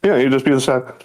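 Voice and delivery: usual speaking voice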